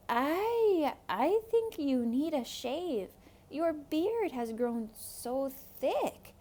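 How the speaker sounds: put-on a worried kid probing the disguise; nervous boy Rico.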